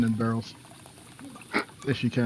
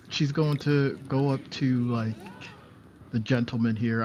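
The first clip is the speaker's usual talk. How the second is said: ordinary talk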